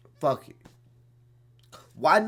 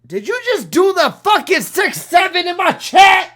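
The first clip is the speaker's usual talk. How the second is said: angry shout; 12 semitones higher, 9 dB louder than usual. Real, not acted. Ranting at chat about six seven.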